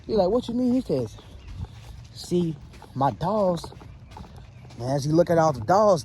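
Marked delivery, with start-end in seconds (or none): 0.3-0.7 higher, puzzled question; trapped man not getting it, pitched up; confused man. Acted. 2.1-3.7 slow, drawn-out sinister line; slow creepy reveal. Acted. Girl reveals dolls slowly.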